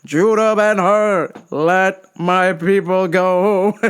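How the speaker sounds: slow, grand, booming prophet voice; hammed-up Moses plea; mock biblical proclamation. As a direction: proclaim it like an epic movie prophet.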